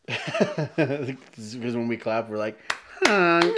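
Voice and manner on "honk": high feeble honk; sustained little horn sound; imitated weak car horn. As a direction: imitate a puny horn as applause. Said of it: "like that little car horn".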